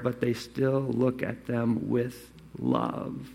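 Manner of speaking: soft tender ending about loving parents. Acted. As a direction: let the love soften the line. Parents' love pictured.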